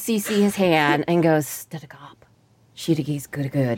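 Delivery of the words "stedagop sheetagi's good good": lower, much softer gibberish; hushed secret gibberish. Acted. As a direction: murmur the made-up words privately. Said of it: "speaks gibberish".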